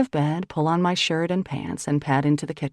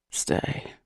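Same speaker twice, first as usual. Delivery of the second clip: breathy low rasp; 5 dB quieter than usual. Acted. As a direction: rasp it out, barely voiced. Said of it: "he rasps".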